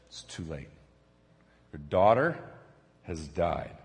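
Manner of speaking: very low, very soft messenger voice; hushed grave news. Acted. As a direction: break bad news quietly, with pauses. Messenger delivering news of death.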